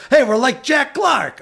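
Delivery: exaggerated high Boston accent, slow and loud. Boston accent quoting a heist movie character. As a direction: ham up a Boston townie line. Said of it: "that's my Boston accent".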